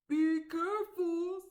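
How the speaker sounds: troll cautioning his goblin neighbour; troll warning. Acted. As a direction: warn him gravely, as a troll.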